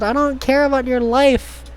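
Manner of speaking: ordinary delivery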